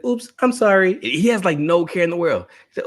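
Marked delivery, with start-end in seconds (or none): 0.0-1.0 put-on much higher, breezy little-kid oops; careless toddler. Son apologizing lightly after an accident, high and breezy. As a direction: sound like a kid without a care.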